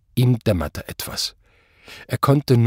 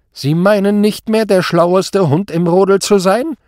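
high, loud, mocking; taunting question; 9 semitones higher, 7 dB louder than usual. A narrator's reading. Superior mocks the commissioner's pride, taunting. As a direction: needle him about his lost pride.

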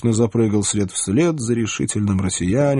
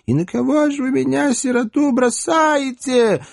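wailing lament. Acted. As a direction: wail it like a village lament. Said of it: "заголосила понятливая израильтянка".

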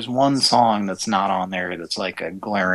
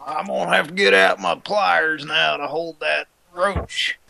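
stoner voice; 8 semitones higher than usual. Pretend. Stoner persona.

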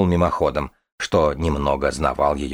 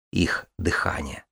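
whispered; 7 dB quieter, slower than usual. A narrator's reading. Ominous reveal of the dragon's breath.

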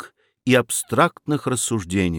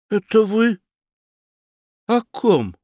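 high, guarded question; wary; 8 semitones higher than usual. A narrator's reading. Fedyashev asks his aunt, wary and high. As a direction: ask cautiously, on guard. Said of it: "настороженно спросил Федяшев".

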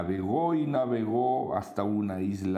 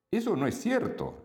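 bat voice; 13 semitones higher than usual. Acted. Murciélago contradice.

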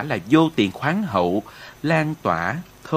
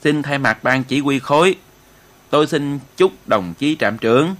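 formal toast, projected a little louder; solemn opening speech; 4 dB louder than usual. A narrator's reading. Commissar opening the New Year feast for the troops, solemn. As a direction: address the gathering ceremoniously. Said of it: "trịnh trọng nói lời khai tiệc".

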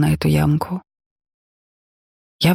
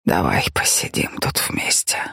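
trembling whisper; 13 semitones lower, 4 dB quieter than usual. A narrator's reading. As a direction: whisper it through tears, voice shaking. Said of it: "прошептал он дрожащим голосом".

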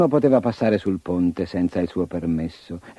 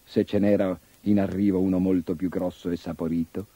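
ordinary delivery

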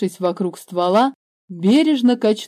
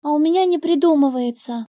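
put-on higher, fast, childlike sad complaint; the girl complaining she can't think of a word; 8 semitones higher, faster than usual. A narrator's reading. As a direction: whine it like a stuck child. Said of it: "грустно сказала Ева".

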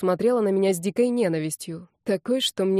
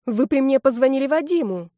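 higher, desperate raised voice; mild shout; 6 semitones higher, 4 dB louder than usual. A narrator's reading. Redhead contradicts her boss, crying out. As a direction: cry out in protest, pitch lifted. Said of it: "закричала рыжая".

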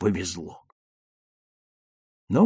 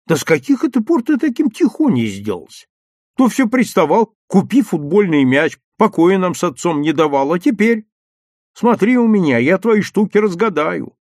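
high, raised motherly telling-off; indignant, pressing reproach; scolding mother; 7 semitones higher, 6 dB louder than usual. A narrator's reading. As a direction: scold a son with exasperation. Mother keeps scolding her son.

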